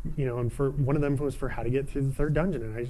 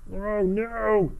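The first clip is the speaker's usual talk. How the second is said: surprised; 8 semitones higher than usual. Real, not acted. Sudden danger in the game.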